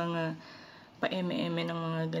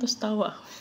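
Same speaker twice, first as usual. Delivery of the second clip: normal talking voice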